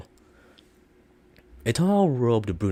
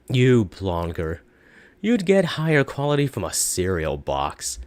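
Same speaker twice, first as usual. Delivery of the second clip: mocking; 4 dB louder than usual. Shopkeeper gloating after the buyer leaves, loud and mocking. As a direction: gloat and mock him. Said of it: "mockingly exclaiming".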